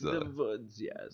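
soft put-on German accent; hushed mock German. Shown as a demonstration. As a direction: confirm quietly in a German accent. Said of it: "speak with your best German accent".

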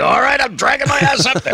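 high, far-off imitation of Biden; Joe Biden. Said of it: "Is that how he sounds in the morning?".

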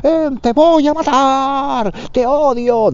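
high, hammed-up shrieked lines; melodramatic shouting actor. Amateur actor yelling at scene partner, high hammed-up shriek. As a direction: overact it like a bad drama student. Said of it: "decían todas esas cosas".

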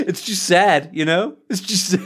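words broken by laughter; giggling while saying it; laughing. Real, not acted.

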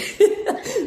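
a high burst of laughter, falling away; real laugh. Real, not acted. Guest laughing at how fast she bores of crying, high real laugh. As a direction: laugh at yourself, high and bright.

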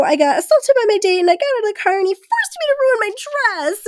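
high, fast, breathless dramatic rant; breathless mock damsel. Imitating the heroine's dramatic call for help, high and fast. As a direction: gush it like a damsel. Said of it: "she does this whole thing".

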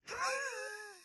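high squealing laugh. Real, not acted. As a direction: burst into a shrill laugh. Laugh after doctor joke.

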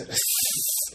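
high, breathy monster hiss; creature hiss. A narrator's reading. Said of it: "lets out this incredibly loud hiss".

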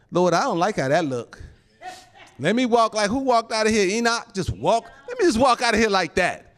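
high-pitched, animated plea to the Lord; pleading with God. Retold or imitated.

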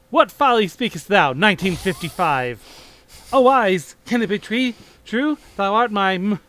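loud, high theatrical shock; hammy stage actor. Acted. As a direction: overact the disbelief. Marty shocked at the year.

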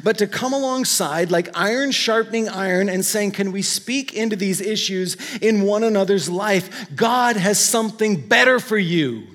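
loud, high impassioned preaching of the quote; fervent preaching. Acted. Pastor urging his congregation, loud fervent preaching.

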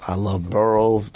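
ordinary talk, nothing marked

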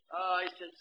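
ordinary delivery

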